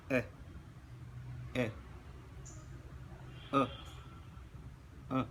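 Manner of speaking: each "A" very short and separate; brief, isolated "A" sounds with pauses; short vowel demonstration. Shown as a demonstration. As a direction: cut each "A" off quickly. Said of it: "Now I will pronounce".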